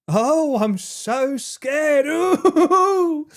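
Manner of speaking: high, drawn-out mock fright; mock scared. Mocking a threat that scares nobody, mock scared. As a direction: feign terror, rising to a wailing laughing ooh. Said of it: "You wouldn't necessarily".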